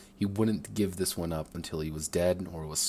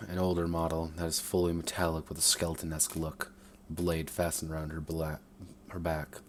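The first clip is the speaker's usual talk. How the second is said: plain reading voice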